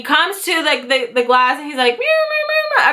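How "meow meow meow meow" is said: very high meowing like a cat; imitating cat meows. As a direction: meow like a little cat calling. Imitating the cat meowing behind glass.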